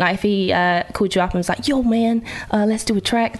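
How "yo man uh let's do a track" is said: casual mock-American accent, slightly lifted; British speaker slipping into an American accent. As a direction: put on a playful American accent. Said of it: "I'm doing an American accent".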